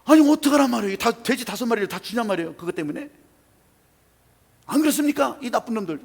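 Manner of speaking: loud, high indignant cry; furious outburst. Retold or imitated. Angry man continues his outburst. (분통을 터뜨리며 크게 외치는 목소리)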